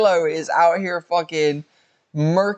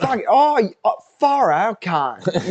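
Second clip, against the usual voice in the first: higher, hammed-up Australian exclamation; mock Australian accent; 6 semitones higher than usual. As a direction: play an amazed Aussie bloke, over the top.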